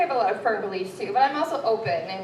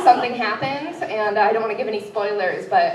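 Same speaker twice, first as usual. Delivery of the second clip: ordinary delivery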